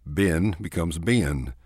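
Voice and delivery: "ben" stretched into two syllables, "be-en"; Texas two-syllable Ben. Shown as a demonstration. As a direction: drawl "ben" with a Texas lilt. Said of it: "convert one-syllable words to two-syllable words".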